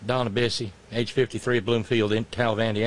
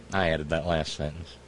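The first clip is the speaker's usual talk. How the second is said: ordinary talk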